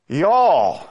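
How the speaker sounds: pause, then punch out "y'all" alone; isolated, emphatic "y'all" swooping down; stress on y'all. Shown as a demonstration. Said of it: "something special about the Southern dialect".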